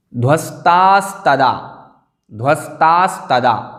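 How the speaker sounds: ध्वस्तास्तदा slow, with long "staa" and audible second s; teacher models ध्वस्तास्तदा slowly, sounding both s sounds; careful pronunciation. Shown as a demonstration. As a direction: draw out ध्वस्तास्तदा as dhvas-TAAS-tadaa. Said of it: "सकार भी बोलना है".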